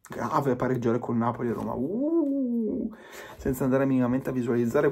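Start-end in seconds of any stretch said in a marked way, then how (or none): 1.7-2.9 high, mocking "uh" of fake shock; a hammed-up shocked gasp. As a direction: gasp as if scandalised, tongue in cheek. Mocking fans' shock at draws.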